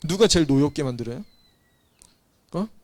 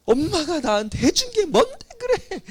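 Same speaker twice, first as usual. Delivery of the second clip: put-on much higher, whiny teen talking back; resentful teen; 13 semitones higher than usual. Teenager talking back to mother, resentful. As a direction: sneer it like a kid blaming mom.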